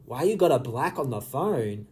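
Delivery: puzzled rereading. Real, not acted. Reader going back over a confusing message, quietly puzzled. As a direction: mull the question over, dropping in pitch. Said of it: "I am so confused".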